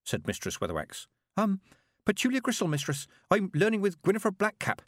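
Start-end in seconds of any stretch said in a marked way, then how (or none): 1.4-4.8 put-on nervous young girl. A narrator's reading. Petulia voiced in story.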